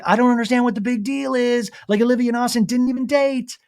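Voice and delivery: high, put-on baffled complaint; mock indignation. Daughter vents to mom on FaceTime, mock indignant.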